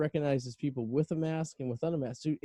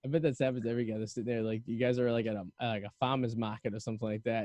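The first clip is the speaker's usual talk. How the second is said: ordinary talk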